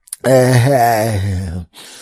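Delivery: loud, drawn-out wail of dismay; mock anguished scream. Teenage self seeing the bug in his cheesesteak, loud mock wail. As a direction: cry out in comic horror.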